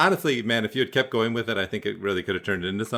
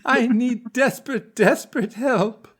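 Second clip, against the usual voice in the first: haunting, very high, drawn-out plea; slow falsetto damsel, hammed-up and desperate; Mary Steenburgen; much slower than usual. Playing a woman begging Steven for help, haunting high voice. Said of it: "the most haunting, beautiful voice".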